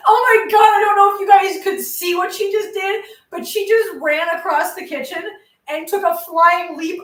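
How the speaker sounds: high, loud, excited outburst that settles as she explains; genuinely startled, laughing as she yells out; startled excitement. Real, not acted. Cat leapt onto her leg.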